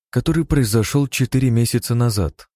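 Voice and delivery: ordinary delivery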